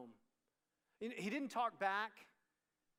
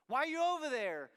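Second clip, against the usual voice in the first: higher, louder mock-parent; accusing parent; 7 semitones higher, 9 dB louder than usual. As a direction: demand it like a parent.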